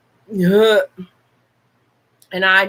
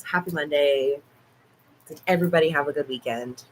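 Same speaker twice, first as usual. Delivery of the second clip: normal talking voice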